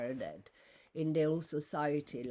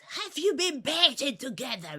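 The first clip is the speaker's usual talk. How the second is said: high, loud imitation of a woman shouting; retold shout; 11 semitones higher, 9 dB louder than usual. Woman shouts her question at the speaker after getting no answer, shouting. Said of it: "And she shouts".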